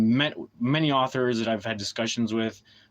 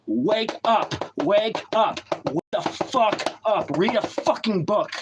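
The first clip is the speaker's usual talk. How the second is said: higher, yelled wake-up call; shouted command; 7 semitones higher, slower than usual. Real, not acted. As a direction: shout them awake. Yelling at viewers.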